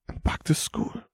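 high, breathy whisper near tears; tearful whisper. A narrator's reading. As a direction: whisper it, close to crying. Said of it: "the boy whispered".

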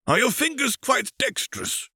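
put-on high, airy voice of an old alien master; alien Jedi master. Thespiasian master briefing Obi-Wan, in a high, stately master voice. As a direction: speak up high like a serene ancient teacher.

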